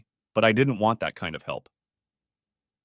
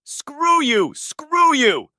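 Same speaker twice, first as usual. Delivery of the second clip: high, loud furious yell; shrieking at him in a motel room; 7 dB louder, much slower than usual. Acted. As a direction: scream it at him twice. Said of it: "I yelled".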